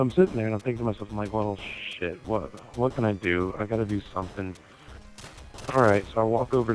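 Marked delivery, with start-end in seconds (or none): none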